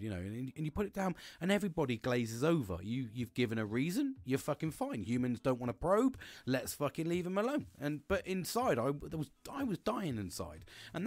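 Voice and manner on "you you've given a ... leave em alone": ordinary delivery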